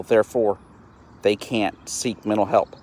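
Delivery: ordinary talk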